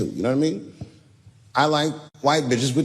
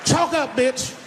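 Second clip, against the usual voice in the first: high mock club boss yell; yelling at a stripper; 8 semitones higher than usual. Strip club owner ordering a dancer, high mock yell. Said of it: "I come like".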